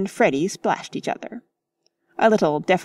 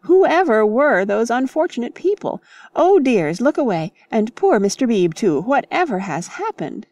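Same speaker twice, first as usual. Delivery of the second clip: high, loud scandalised fluster; flustered alarm; 4 semitones higher, 4 dB louder than usual. Acted. Missus Honeychurch shields the ladies, flustered alarm. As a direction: fuss over the shocking sight. Shocked lady reacting.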